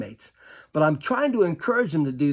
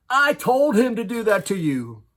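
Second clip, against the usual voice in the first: higher, louder, insistent admission; forceful confession; 7 semitones higher, 7 dB louder than usual. Retold or imitated. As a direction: blurt out the truth to stop him. Coach reveals the plan.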